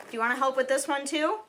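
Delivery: high quick coaxing voice; pet talk. Real, not acted. Asking her dog to help open the gift in pet voice.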